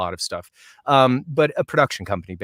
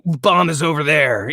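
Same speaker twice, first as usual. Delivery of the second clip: Counter-Strike announcer voice; 4 semitones higher, 4 dB louder than usual. Acted. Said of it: "do the voice".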